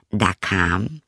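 usual speaking voice

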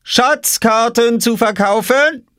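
high, long-drawn hawker's cry; calling out for sale. A narrator's reading. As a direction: sing out your wares, stretching it. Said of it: "rief Oskar hinter seiner Obstkiste".

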